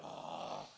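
long, quiet breathy groan; disappointed sigh. Acted. Archers all deflated at the order, a disappointed sigh. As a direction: sigh out your letdown.

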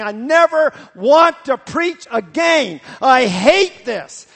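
loud, slow, high shout of frustration; retold yell. Pastor driving home, yelling at God in frustration. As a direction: bellow it like you're alone in the car. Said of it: "I was yelling at God in the car".

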